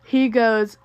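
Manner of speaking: ordinary talk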